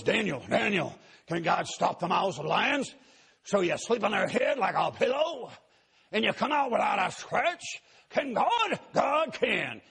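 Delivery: rising to a high, loud preaching cry; gravelly old preacher. Retold or imitated. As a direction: thunder it from the pulpit like a revival preacher. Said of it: "He goes".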